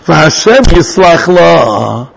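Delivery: very slow, stretched verse quotation; drawn-out recitation. Shown as a demonstration. Reciting the verse under discussion.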